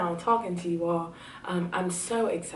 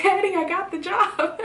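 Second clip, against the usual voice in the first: much higher and louder, overjoyed; thrilled, near squeal; excited announcement; 13 semitones higher, 5 dB louder than usual. Retold or imitated. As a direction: burst out the news to Daddy. Telling dad the news.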